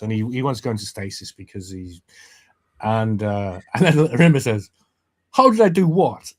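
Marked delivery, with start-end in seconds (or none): 5.3-6.3 high, loud comeback in an acted quote; acted indignant question. Rimmer answering Lister out of nowhere, loud and puzzled. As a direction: throw the question back, raised and puzzled. Retelling Rimmer's non sequitur reply.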